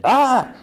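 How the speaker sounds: short acted scream at no extra volume; mild pretend shriek of fright; mock startled yell. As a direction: imitate a startled "ah". Man sees masked doll by bed.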